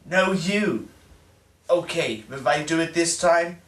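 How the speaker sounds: usual speaking voice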